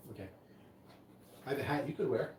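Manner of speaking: ordinary delivery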